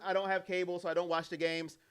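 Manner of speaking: ordinary delivery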